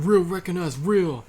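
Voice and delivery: high, loud imitated yell. Retelling how two guys kept yelling, loud mock yell. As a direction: yell it in someone's face. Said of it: "they kept yelling".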